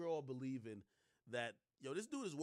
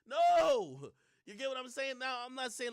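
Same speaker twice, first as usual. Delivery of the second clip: sudden shout, much higher and louder; loud emphatic no; 13 semitones higher, 16 dB louder than usual. Real, not acted. Streamer mocking men who apologize, loud emphatic no. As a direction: slam the door on the apology.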